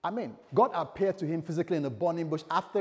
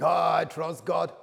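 louder, higher, drawn-out mock epiphany; mock sudden revelation; 4 semitones higher, 9 dB louder, slower than usual. Pastor mocks trust dawning automatically, hammed-up realization. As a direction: stretch it like a hammed-up awakening.

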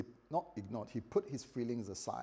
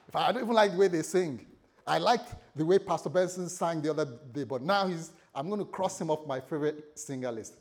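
acted huffy rant, pushed louder; annoyed griping; 4 semitones higher, 8 dB louder, faster than usual. Disgruntled member turning on Pastor Benson, annoyed. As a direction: gripe loudly in mock indignation. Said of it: "they were annoyed".

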